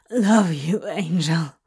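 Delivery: breathless endearment, dropping at the end; breathless hiss. A narrator's reading. Demon to his angel, hissed breathlessly. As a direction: say it short of breath. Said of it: "he hissed breathlessly".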